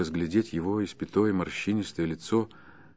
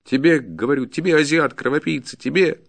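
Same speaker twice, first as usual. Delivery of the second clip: high, insistent, loud abuse; enraged peasant, pitch climbing; angry insults; 7 semitones higher, 6 dB louder than usual. Acted. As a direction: throw the insults straight at him.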